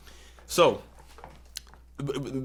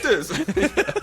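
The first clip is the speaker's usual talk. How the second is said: high, laughing get this; gleeful filming; 11 semitones higher, slower than usual. Retold or imitated. Producer thrilled by the chaos, laughing high. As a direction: urge the camera on. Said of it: "I was like".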